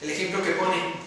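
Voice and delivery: ordinary talk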